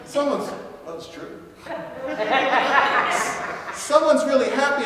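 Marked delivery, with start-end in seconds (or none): none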